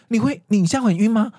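acted-out alarmed question; alarmed concern. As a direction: imitate a nurse suddenly worried about a patient. Nurse reacts to patient's dizziness.

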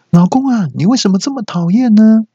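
high sing-song wife nagging her husband. Acted. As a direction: whine the nag like a sweetly menacing wife. (妻子尖声撒娇式地碎念老公)

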